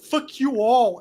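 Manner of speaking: loud, high parting shout; angry outburst. Acted. Humiliated bully before bolting to the woods, shouting. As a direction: hurl it at the whole crowd. Crying bully curses everyone, then bolts.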